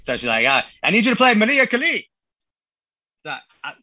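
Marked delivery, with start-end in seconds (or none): 0.5-2.1 higher and louder, imitating his wife's Mexican accent. Wife demanding her song from the DJ, in a Mexican accent. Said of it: "the impersonation is great".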